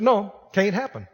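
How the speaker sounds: usual speaking voice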